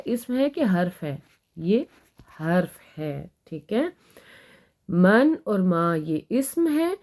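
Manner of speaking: ordinary talk, nothing marked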